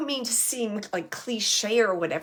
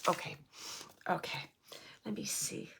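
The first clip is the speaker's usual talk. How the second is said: breathy whisper to herself, much softer; whispered aside; 6 semitones lower, 13 dB quieter than usual. Real, not acted. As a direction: murmur it under your breath, almost silent. Medium murmuring to herself.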